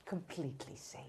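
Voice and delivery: very soft, hushed comforting reply; therapist-like hush; soothing reassurance. Acted. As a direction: reassure gently, barely above a murmur. Host reassures a tearful guest.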